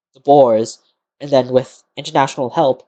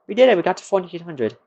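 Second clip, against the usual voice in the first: excited cheer; 4 semitones higher, faster than usual. Real, not acted. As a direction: cheer the win. Celebrating reaching a goal.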